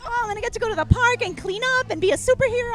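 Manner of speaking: fast and high, bubbling over like a thrilled fourth-grader; high, quick childish excitement; excited kid. Retold or imitated. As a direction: rattle it off like a kid who can't wait. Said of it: "I was so excited".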